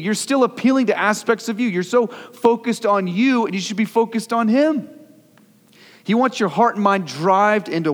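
high-pitched, forceful rebuke from the pulpit; urgent preacher driving it home; impassioned preaching. Real, not acted.

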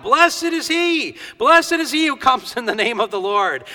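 high, ringing acclaim; crying out. Retold or imitated. Crowd hailing Jesus entering Jerusalem, crying out joyfully. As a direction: call out like a cheering crowd. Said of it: "they were crying out".

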